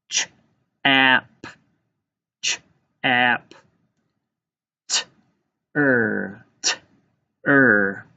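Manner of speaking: "Ch, ap", then "T, er", each sound on its own. Shown as a demonstration. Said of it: "Let's tap out the first syllable in chapter".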